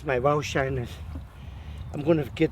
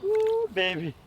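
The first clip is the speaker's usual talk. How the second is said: excited coo; slower than usual. Real, not acted. Excited at fish.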